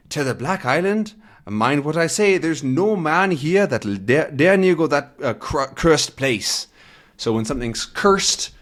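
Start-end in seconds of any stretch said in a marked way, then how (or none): 0.1-6.7 Scottish warning. Acted. Third boatman warns Tintin.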